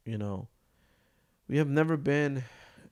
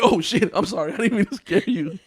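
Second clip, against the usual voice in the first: high, laughing apology; 9 semitones higher, 9 dB louder than usual. Retold or imitated. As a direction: apologize while cracking up. Retelling startled apology.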